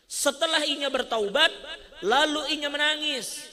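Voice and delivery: rising sermon delivery, loud and urgent; forceful preaching. Acted. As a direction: preach it out to the congregation, pushing hard. Preacher builds to full force.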